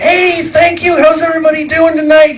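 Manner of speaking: high, loud showman greeting; stage announcer. Retold or imitated. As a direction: hype the crowd like a bandleader. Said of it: "and then I went".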